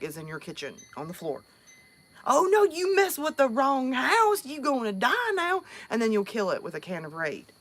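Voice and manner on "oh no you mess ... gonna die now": mock-menacing Southern threat to a bug; a lightly voiced threat to a water bug; threatening a bug. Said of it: "we'll talk to it before we kill it".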